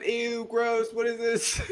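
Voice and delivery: high, squealing ew through laughter; grossed-out giggle; laughing disgust. Pretend. Imagines bugs in syrup.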